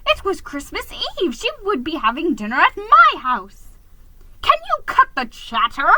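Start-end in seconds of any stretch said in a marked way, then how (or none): 4.4-5.9 higher, louder exasperated yell. A narrator's reading. Geronimo yelling at the chattering fish, loud and high. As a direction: snap at him to stop. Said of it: "I yelled".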